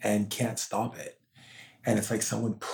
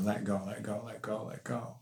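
soft urging; 11 dB quieter than usual. Retold or imitated. Ancestors urging her to let go.

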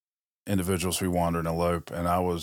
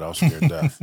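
mock panic; 9 semitones higher, 6 dB louder than usual. Retelling panic before first speech.

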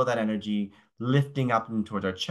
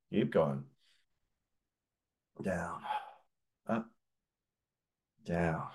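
steady instructor cues, softening as the reps go on; breathwork cues; 3 dB quieter than usual. Acted. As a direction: call each breath like a calm yoga teacher. Leads arm-and-breath movement.

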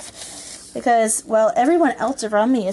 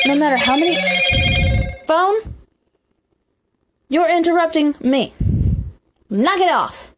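higher-pitched scolding at an interruption; barking at phone; 6 semitones higher than usual. Real, not acted. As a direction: tell off the ringing phone. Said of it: "I barked at the phone".

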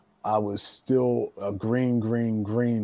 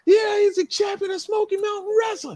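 very high-pitched exasperated yell; retold outraged shouting; going nuts. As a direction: imitate a promoter ranting in disbelief. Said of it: "He's going nuts".

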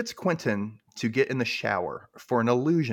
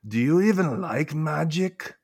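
slow, higher put-on accent; Mayakovsky accent; 6 semitones higher, 3 dB louder, slower than usual. Recapper voices Mayakovsky questioning Penny, put-on accent. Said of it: "I have to do this accent with him".

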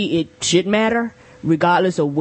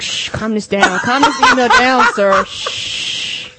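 hushing a shouty email; 6 semitones higher, 4 dB louder, slower than usual. Retold or imitated. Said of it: "You be like".

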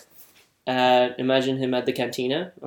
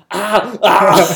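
loud, raised mock cries; Bill Burr doing Schwarzenegger; 4 semitones higher, 7 dB louder than usual. Comedian imitating Schwarzenegger with his maid, loud mock cries. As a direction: belt out comic cries. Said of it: "his impression of Schwarzenegger sleeping with his".

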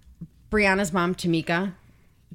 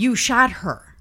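raised, louder retold outburst; angry accusation; 6 semitones higher, 6 dB louder, slower than usual. Boyfriend in custody to police, angry retold accusation. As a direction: imitate a distraught accusation, pitched up and loud.

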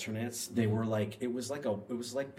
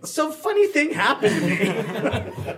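much higher, slow and laughing; high, slow, giggly confession to parents; sheepish laugh; 11 dB louder, slower than usual. Retold or imitated. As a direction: ease into awkward news with a giggle. Breaking news to parents sheepishly.

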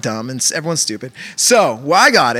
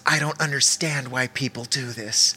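soft, prim voice of a disapproving lady; a lightly voiced complaining woman; 3 semitones lower, 9 dB quieter than usual. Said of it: "she goes".

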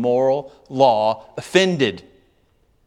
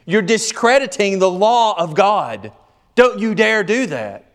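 indignant, higher-pitched rebuke; angry accusation; 5 semitones higher than usual. Acted. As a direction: scold with rising outrage. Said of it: "you're angry about it".

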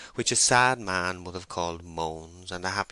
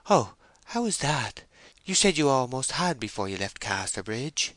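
higher female character voice, hurt; dismayed young woman; 6 semitones higher than usual. Acted. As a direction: question him in timid dismay.

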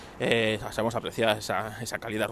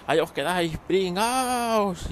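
mock villain gloating, pitched higher; cartoon supervillain taunt, much higher; mocking Elon Musk; 8 semitones higher, slower than usual. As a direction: sneer a farewell as you fly off. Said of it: "y dice".

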